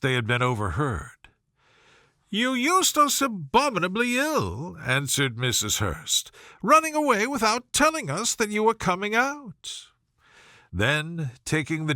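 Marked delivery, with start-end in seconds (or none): 2.3-4.8 much higher, huffy complaint; a peevish lady's high protest; Mrs Hurst complaining. Acted. 6.6-9.9 much higher, huffy complaint; a peevish lady's high protest; Mrs Hurst complaining. Acted.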